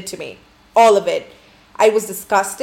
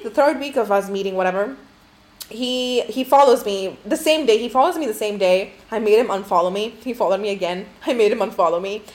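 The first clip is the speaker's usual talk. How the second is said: ordinary talk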